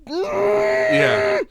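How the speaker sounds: very high mock yell; mock aggressive scream. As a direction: scream it like an angry Italian. Mocks aggressive Italian screaming.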